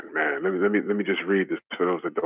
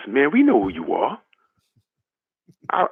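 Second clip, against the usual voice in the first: usual speaking voice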